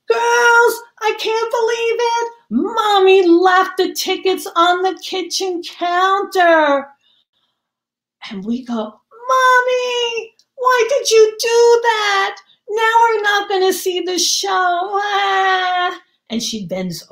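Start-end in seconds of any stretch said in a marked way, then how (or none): 0.1-6.9 high, loud, hammed-up shock about the forgotten tickets; mock dismay. Mother fakes dismay for ticket man. 8.7-16.0 high, loud, wailing child's fake sob; put-on crying. Children fake tears on cue.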